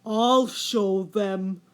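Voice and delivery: slow, deep-toned angry threat; angry whale. A narrator's reading. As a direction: grumble it slowly like a wounded giant. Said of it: "he grew very angry".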